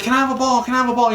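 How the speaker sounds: put-on high, pleading kid voice asking over and over; a little kid pestering for a baseball; begging kid. Kid in the stands begging the outfielder, high and pleading.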